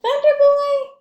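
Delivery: high, loud and proud, ringing out the name; a grand storybook name call; big name announcement. Acted. Reading title name with flourish.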